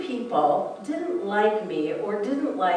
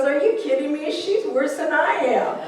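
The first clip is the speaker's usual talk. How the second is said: amused husband; 6 semitones higher than usual. Retold or imitated. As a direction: tease as Art laughing it off. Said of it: "he goes".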